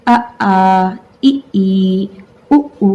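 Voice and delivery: अ आ इ ई उ उ spoken slowly and clearly, each vowel held apart; reciting vowels. Shown as a demonstration. Teacher leading the class in saying the vowels, slow and clear. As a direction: sound out अ, आ, इ, ई, उ, उ one by one for the class to repeat. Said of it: "चलिए बोल के देखिए".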